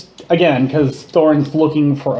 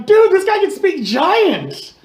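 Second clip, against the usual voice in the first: amazed; 13 semitones higher, slower than usual. Acted. Character back to common, amazed.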